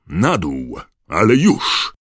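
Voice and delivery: loud command jumping up at the end; angry thundering. A narrator's reading. Professor orders the poltergeist down, thundering angrily. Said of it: "zagrzmiał rozeźlony głos".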